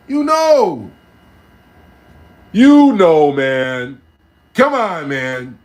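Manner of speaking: exasperated appeal to the listeners to get it; exasperated insistence. Real, not acted. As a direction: push it home, exasperated. Exasperated, obvious implication.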